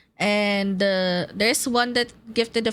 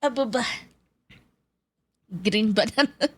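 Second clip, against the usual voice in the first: ordinary talk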